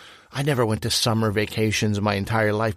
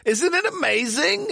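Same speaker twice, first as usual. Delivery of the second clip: mock amazement. Mock delight at the guest.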